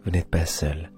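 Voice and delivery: ordinary delivery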